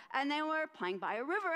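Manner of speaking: ordinary delivery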